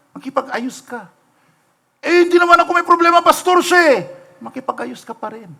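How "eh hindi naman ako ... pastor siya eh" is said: high, fast, whiny excuse; much higher, quick protest to the pastor; defensive churchgoer. Retold or imitated. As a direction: whine that it's the other guy's fault. Church member excusing himself to pastor.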